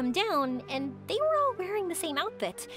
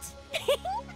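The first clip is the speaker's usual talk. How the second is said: short high giggle; excited giggle; 13 semitones higher than usual. Acted. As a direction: let out a giddy little high-pitched laugh. Excited about the trip ahead.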